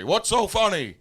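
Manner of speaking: loud retold outburst of anger; angry. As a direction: mimic an irate superior shouting. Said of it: "All angry".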